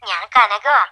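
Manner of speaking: simple introduction down the phone line; phone-filtered self-introduction; phone caller. Acted. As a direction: give your name as a caller on the phone. Caller first gives her name.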